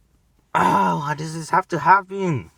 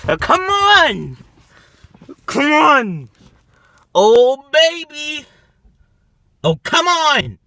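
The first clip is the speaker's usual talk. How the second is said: frustrated groan; 6 semitones higher, 4 dB louder, slower than usual. Real, not acted. Frustrated prank scare falling through.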